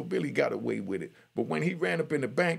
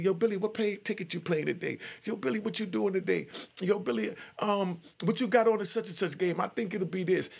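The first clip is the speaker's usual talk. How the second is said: higher, eager voices begging Billy for goods; pestering inmates; 7 semitones higher than usual. Retold or imitated. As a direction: call out to Billy like a needy inmate. Inmates crowding Billy with questions.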